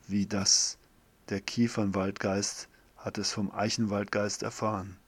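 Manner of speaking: usual speaking voice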